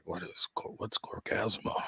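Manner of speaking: whispered, puzzled fan reading a sign; confused fan at the booth, whispering; hushed whisper. Retold or imitated. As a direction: breathe it like a baffled passerby.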